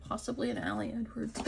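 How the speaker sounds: ordinary talk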